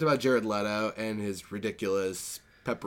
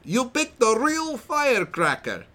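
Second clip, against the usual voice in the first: high-pitched mock Italian accent; over-the-top Borat-esque Italian accent; hammy Italian-Borat accent; 10 semitones higher than usual. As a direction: read it in a ridiculous Italian accent going into Borat. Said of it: "I'm gonna read it in the best accent that I can".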